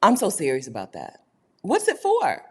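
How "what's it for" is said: emphatic question. Real, not acted. Rhetorical emphatic question on spending.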